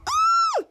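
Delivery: very high, thin mock scream; imitated scream. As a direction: squeal like a frightened woman. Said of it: "Bianca screams".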